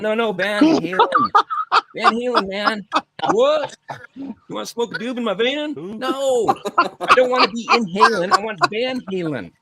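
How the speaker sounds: put-on high, insistent, exasperated teen; exasperated kid. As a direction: correct the clerk, getting fed up.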